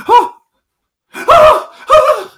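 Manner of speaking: high piercing panicked screams; screaming. Retold or imitated. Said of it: "kept on screaming".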